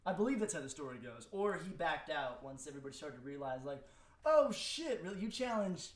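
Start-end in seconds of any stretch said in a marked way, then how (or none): 4.2-5.9 mock alarm, pitched high and loud; startled realization. Rapper imagining the shock at who was challenged, startled. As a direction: blurt it as an imagined stunned reaction.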